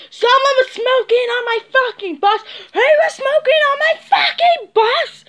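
furious driver shouting down the aisle; imitating bus driver yelling. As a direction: bellow at the whole bus like an outraged driver.

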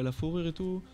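ordinary talk

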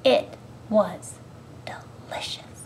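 hushed, drawn-out relish; soft storybook secret; savouring whisper. A narrator's reading. Savouring the story's last treat.

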